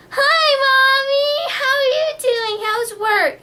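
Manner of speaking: put-on very high, slow, sing-song child; little kid voice. Imitating her child self chatting to mom on a toy phone. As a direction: chirp it like a tiny girl on a toy phone.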